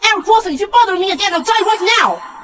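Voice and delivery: shrieking fast and high at her father; furious scream. Acted. Daughter screaming at her father to leave her alone, furious. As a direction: scream it out, at the end of her rope.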